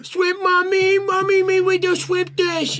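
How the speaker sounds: put-on very high, slow, loud little-kid whine; whiny toddler voice. Child begging mom for shrimp, in a very high whiny voice. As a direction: beg mommy like a tiny child. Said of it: "You go".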